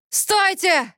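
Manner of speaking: high, strained hoarse shout; hoarse cry. A narrator's reading. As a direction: croak out an urgent halt. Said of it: "захрипел Кипер". (хриплый отчаянный окрик)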